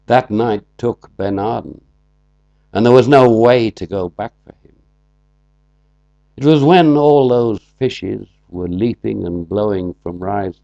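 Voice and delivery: loud, measured recital of his own poem; poetry reading. Acted. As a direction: declaim the poem to the room, with long pauses. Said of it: "Do that one for us".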